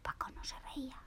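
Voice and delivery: very soft suspenseful whisper. A narrator's reading. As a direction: hold your breath and whisper it. Suspense: Paco aguanta la risa. (susurro muy bajo, lleno de suspense)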